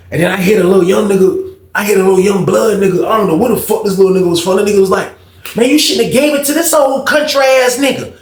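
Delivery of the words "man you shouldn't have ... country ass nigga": higher, lightly aged voice; a hint of an old-timer scoffing; old man voice. Young stranger scolding him, done in a light old voice. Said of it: "He had one of them old voices like that".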